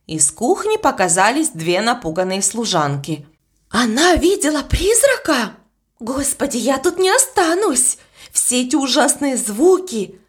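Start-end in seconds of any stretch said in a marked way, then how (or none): 3.7-10.2 mock-frightened maid, voice lifting higher; panicky, wanting to run. A narrator's reading. Young maid frightened of the ghost, lightly voiced panic. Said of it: "плакала и дрожала".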